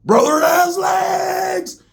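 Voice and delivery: drawn-out, very high-pitched shout; thrilled yell. Retold or imitated. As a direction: yell it out slowly, voice shooting way up. Roach thrilled by the dope.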